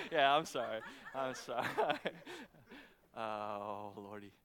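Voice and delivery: giggling through sorry and lordy; can't stop laughing; laughing fit mid-sermon; laughing apology. Real, not acted.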